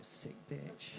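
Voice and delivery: low, soft, voiceless aside; muttered insult. Acted. Comedians emailing The Guardian about her, muttered condemnation. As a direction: mutter it under your breath as an insult.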